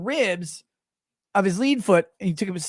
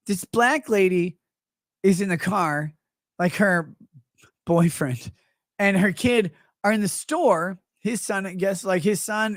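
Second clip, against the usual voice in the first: normal talking voice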